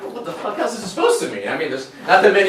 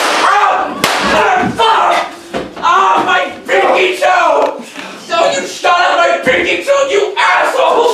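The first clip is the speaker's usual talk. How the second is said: high, loud agonized yelling; outraged shrieks of pain; screaming in pain; 9 semitones higher, 4 dB louder, slower than usual. Acted. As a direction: howl over a shot toe. He is shot in the toe.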